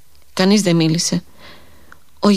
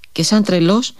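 ordinary delivery, nothing marked

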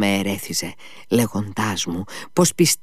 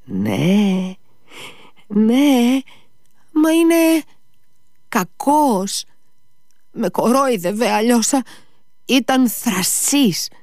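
high, strained, wounded outrage; trembling at insult; much slower than usual. A narrator's reading. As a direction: recall the insult, voice pitched very high. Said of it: "πρόφερε ο Ιβάν τρέμοντας την ανάμνηση της προσβολής".

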